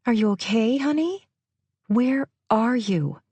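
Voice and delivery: anxious questions down the phone; Lorna's voice. A narrator's reading.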